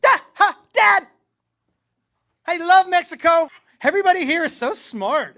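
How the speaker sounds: loud, high, bursting with joy; a little boy gushing to his dad; excited kid. Retold or imitated. Son thrilled at arriving in Mexico, shouting happily.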